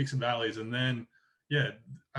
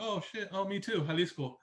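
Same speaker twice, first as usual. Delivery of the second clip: higher-pitched delighted recognition; retold "me too" moment, pitched up; imitated happy surprise; 7 semitones higher than usual. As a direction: act out finding someone from home. Acted discovery of shared home state.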